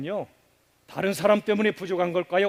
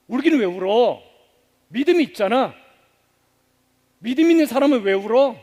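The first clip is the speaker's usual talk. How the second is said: preachy rebuke; 3 semitones higher, 4 dB louder than usual. Retold or imitated. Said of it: "우리는 흔히 말하죠".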